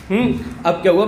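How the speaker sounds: ordinary talk, nothing marked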